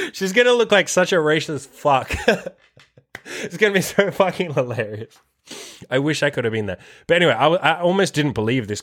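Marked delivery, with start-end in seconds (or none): 0.1-2.5 high-pitched, laughing through the words; real amused laughter breaking in. Real, not acted. Cracks up at imagined scene. 3.4-5.2 higher voice, still cracking up; real amused giggly delivery; laughing. Real, not acted. Still laughing at imagined scene.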